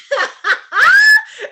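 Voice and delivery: high, loud shrieking laugh. Real, not acted. Woman recalling bed bug bites, loud shrieking laugh.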